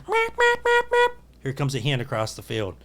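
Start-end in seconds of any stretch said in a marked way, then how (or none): 0.1-1.1 high-pitched turkey hen yelps; imitated hen call. Hunter imitating the hen heard in the field, high yelping. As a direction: squeak out evenly spaced hen calls. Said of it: "I hear".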